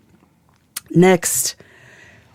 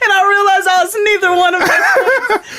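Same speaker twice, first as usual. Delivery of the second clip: high, loud talking through laughter; real laughing; 4 dB louder than usual. Real, not acted. Telling co-host the song was almost on the list, laughing hard. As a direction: crack up while getting the words out. Cracks up over the song choice.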